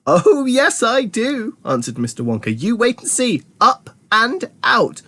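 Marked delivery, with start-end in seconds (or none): 0.1-1.6 high, delighted confirmation; high-pitched merry insistence; gleeful. A narrator's reading. Wonka gleefully confirms to alarmed Grandpa. 2.5-5.0 high, drawn-out announcement; giddy high-pitched promise; gleeful. A narrator's reading. Wonka gleefully announces his plan.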